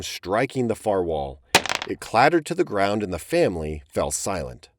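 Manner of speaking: ordinary delivery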